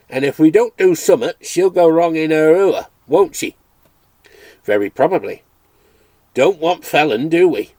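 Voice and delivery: a cheerful Dales farmer calling at the door; Yorkshire farmer. Acted. Farmer continues.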